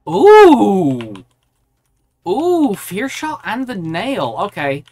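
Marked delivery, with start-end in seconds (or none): 0.1-1.2 loud, drawn-out high ooh; a big rising-then-falling ooh of surprise; excited ooh. Real, not acted. Reacts to something in the game.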